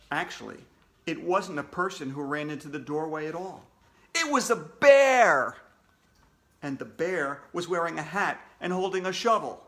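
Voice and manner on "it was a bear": much louder, higher, slow exclamation; dramatic reveal. Acted. Narrator revealing the bear to the kids, loud and dramatic. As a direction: announce it with big drama.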